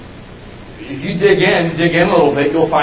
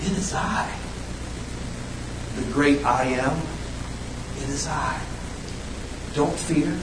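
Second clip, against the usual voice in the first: whispered and much softer, calm; hushed voice of Jesus; 10 dB quieter than usual. Retold or imitated. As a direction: whisper it gently as Jesus calming the disciples. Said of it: "He says".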